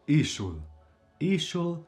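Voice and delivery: ishul stressed on opening I; first-syllable stress. Shown as a demonstration. Teacher showing an exception to learners, ishul stressed on I. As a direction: hit the I of ishul, drop the rest. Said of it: "the first syllable is stressed".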